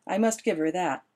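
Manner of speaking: usual speaking voice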